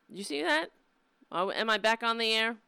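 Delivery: usual speaking voice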